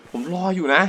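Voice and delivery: ordinary delivery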